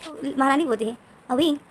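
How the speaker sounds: usual speaking voice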